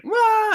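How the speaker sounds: high-pitched yelp of a victim; mock startled cry. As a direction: imitate someone grabbed by surprise. Random human grabbed by face hugger.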